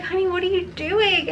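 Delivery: high, mock-astonished question; wife finding husband's gift, high and surprised; surprised, touched. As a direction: ask it high, caught off guard.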